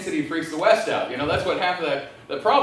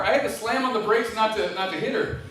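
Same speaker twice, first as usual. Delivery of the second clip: ordinary talk, nothing marked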